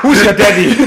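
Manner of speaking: high, loud mock bravado; loud mock macho call. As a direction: belt it out as a cheeky joke. Breaks hypnosis scene.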